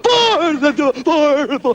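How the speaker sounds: high, loud repeated cry; acted shout. Castaway counting sets of footprints, high acted shout. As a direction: call it out in rising alarm.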